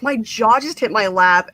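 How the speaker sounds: usual speaking voice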